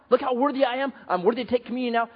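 mock pride. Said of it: "Not pride".

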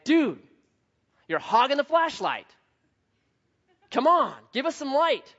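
fed-up heckle at someone hogging things; mad at him. Retold or imitated. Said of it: "they start getting mad".

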